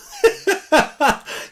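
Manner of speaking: high, loud cackling burst; gleeful laugh. Real, not acted. Collector decides to keep the gift, gleeful laughing. Laughs at keeping the gifted book.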